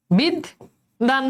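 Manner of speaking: বিদ্যান said very slowly with a doubled দ; slow model pronunciation of বিদ্যান; drawn out. Shown as a demonstration. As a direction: stretch বিদ্যান out, leaning on the দ্দ. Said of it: "উচ্চারণ কিভাবে হচ্ছে".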